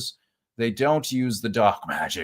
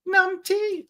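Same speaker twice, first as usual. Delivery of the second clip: very high falsetto; playful name-calling. Pretend. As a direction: tease in a silly high squeak. Repeats the silly greeting voice.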